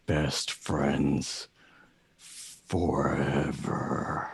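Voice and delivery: low, very slow, ominous raven pledge; deep, drawn-out bird vow; Agfa. Acted. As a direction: drag each word out low for the close-up. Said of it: "the camera zooms in on him".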